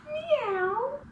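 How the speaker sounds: very high rising meow; cat meow. Shown as a demonstration. Mom meowing for her baby, very high. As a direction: squeak it up like a kitten. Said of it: "The cat goes meow".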